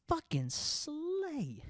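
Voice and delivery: high, drawn-out "fucking slay" with falling end; mock Gen Z slay. Shown as a demonstration. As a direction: stretch "slay" way up high and slow. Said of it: "That's what the kids say".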